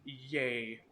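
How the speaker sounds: ordinary talk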